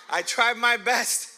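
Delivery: slow, laughing through the words. Real, not acted. To the congregation about his pig, slow and laughing. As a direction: chuckle it out sheepishly.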